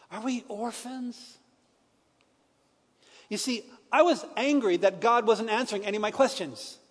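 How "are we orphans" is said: the question again, softer and higher, slow and near tears; softer tearful repeat. Retold or imitated. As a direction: let it fall away almost to nothing as you ask again. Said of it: "in his own tears".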